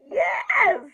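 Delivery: high, loud cry of joy; jubilant yes. Pretend. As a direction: shout it in celebration. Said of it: "I'm gonna be like".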